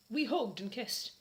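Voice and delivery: usual speaking voice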